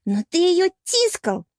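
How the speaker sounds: high, slow, punched accusation; jealous accusation. A narrator's reading. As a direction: accuse him, leaning on each word. Jealous mermaid accuses her boyfriend. (ревнивое обвинение)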